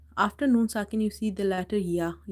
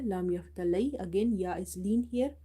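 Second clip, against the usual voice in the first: ordinary delivery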